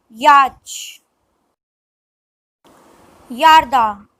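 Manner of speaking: usual speaking voice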